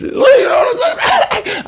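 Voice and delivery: high laughing yell; laughing shout. Real, not acted. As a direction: burst out laughing, squeal it. Laughing at own joke.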